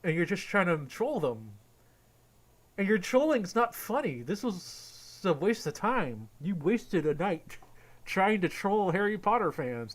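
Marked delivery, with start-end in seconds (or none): 2.8-9.9 slow, deliberate disappointed verdict; slow scolding. Real, not acted. Telling Doug he wasted a night, slow scolding. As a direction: spell it out slowly, disgusted.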